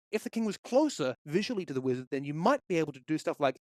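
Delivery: ordinary talk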